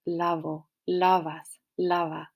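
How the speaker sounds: lavo with a soft b-like v, lips barely touching; soft Spanish v. Shown as a demonstration. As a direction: soften the v in lavo almost to a b. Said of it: "say that V very softly".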